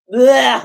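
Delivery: loud, high gagging noise of disgust; real disgust at seeing his own face; disgusted blech. Real, not acted. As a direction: spit out a gross-out sound.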